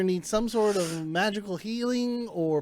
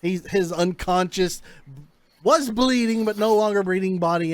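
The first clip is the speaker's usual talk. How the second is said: ordinary talk, nothing marked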